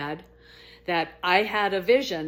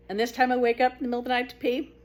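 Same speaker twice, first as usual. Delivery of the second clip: ordinary delivery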